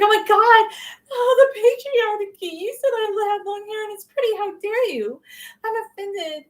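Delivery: hammed-up gasp of offense at a compliment; mock offended feminist. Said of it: "So I'm not gonna be like".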